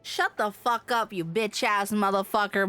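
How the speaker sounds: usual speaking voice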